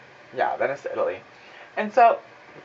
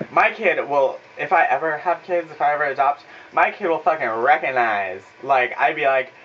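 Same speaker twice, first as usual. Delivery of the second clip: ordinary delivery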